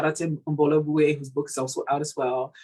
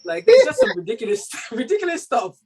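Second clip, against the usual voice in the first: laughing; 7 dB louder than usual. Real, not acted. Laughing about family stories.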